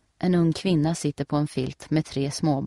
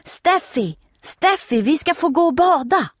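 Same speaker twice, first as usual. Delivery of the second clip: high, loud eager call across the yard; calling from afar; 13 semitones higher, 6 dB louder than usual. A narrator's reading. Nelly calls to Steffi from far off, loud and high. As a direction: shout gleefully from a distance. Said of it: "hon ropar på långt håll".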